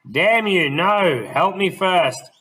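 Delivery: put-on desperate old gent. Blind gentleman begging Smith for help, high, loud, desperate. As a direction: beg hard, dragging out "Help me first". Blind man pleading, grabbing ankle.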